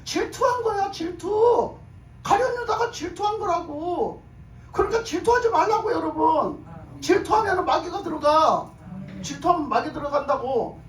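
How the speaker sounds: emphatic pulpit admonishing; full-force preaching. Real, not acted. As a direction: drive the warning home to the congregation, pitched high.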